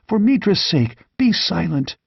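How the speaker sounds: high, panicked plea to hush; frightened outburst. A narrator's reading. Terrified merchant begs Conan to stop talking, high and panicked. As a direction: blurt it out in fear of being overheard. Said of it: "ejaculated Publio".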